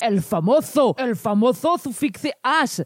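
slow, grand fake Spanish delivery; mock Spanish accent. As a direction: roll it out like a Spanish announcer.

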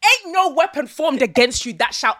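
prayer warrior preaching; high fiery preacher voice; battle yell. Pretend. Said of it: "let my prayer warrior voice come out now".

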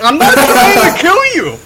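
put-on panicked shouting to the scared old lady; desperate pleading shout.

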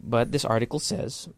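ordinary delivery

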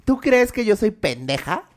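high, slow, indignant demand; confronting the boyfriend in front of the campus, angry; angry challenge. Retold or imitated. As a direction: throw the question at him like an accusation. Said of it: "Le dije".

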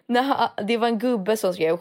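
ordinary talk, nothing marked

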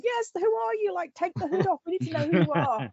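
high-pitched plea for the big reveal; impatient viewers shouting. Retold or imitated. Fans yelling at the TV for the reveal, high and eager. We're all going.

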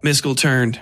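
ordinary delivery

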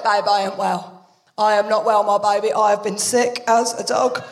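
low, woeful sick voice; poorly mum. Retold or imitated. Mum telling daughter she's been sick, low mock-suffering voice. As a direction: groan it like a hungover mum.

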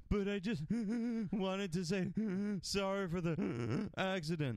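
imitating a bad TV cry scene, gasp after gasp; mock gasping crying. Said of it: "that uncomfortable actor crying where he's like".

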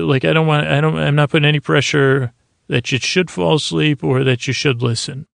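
ordinary delivery

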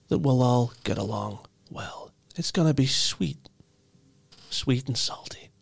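whispered boss menace. Acted. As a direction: lean in and breathe the threat.

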